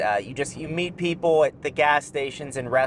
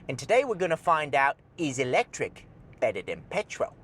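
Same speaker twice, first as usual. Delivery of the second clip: mock British accent; 3 dB quieter than usual. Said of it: "you gotta talk like this".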